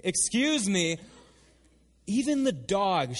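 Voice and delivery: pointed, drawn-out protest; indignant woman. Acted. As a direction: answer back boldly, leaning on each word. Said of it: "Instead, she goes".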